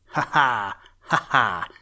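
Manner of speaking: loud teasing ha ha from outside; mocking laugh. A narrator's reading. The bird boy mocking the old rabbit from the window, laughing loud. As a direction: tease him with a cheeky laugh. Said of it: "laughed the voice again".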